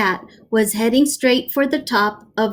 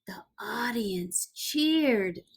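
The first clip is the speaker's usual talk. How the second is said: whisper; 12 dB quieter, slower than usual. A narrator's reading. Whispered narration line.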